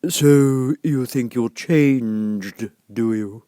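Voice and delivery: very slow, drawn-out creature voice; caterpillar. A narrator's reading. Caterpillar questions Alice, slow and lazy. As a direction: lazily draw it out. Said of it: "and said".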